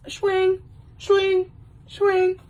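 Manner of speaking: high-pitched, ringing exclamation; three high, separate mock-lustful yelps; movie catchphrase. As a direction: pop each one out high.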